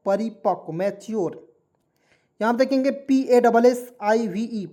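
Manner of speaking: normal talking voice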